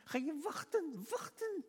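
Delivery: very high, slow mock complaint; high-pitched retold inner griping; grumbling to oneself. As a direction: whine it slowly in acted disbelief. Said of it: "Dus ik zat in mezelf te mopperen".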